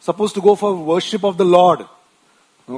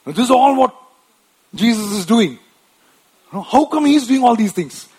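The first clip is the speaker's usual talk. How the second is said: raised, louder puzzled asking; questioning disciples; 6 semitones higher, 6 dB louder than usual. Retold or imitated. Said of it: "his disciples come and say".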